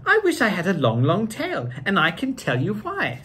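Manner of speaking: lively storybook read-aloud; reading to children. A narrator's reading. Two hosts reading Seuss to kids, lively storybook voice. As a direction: read it like a bedtime picture book.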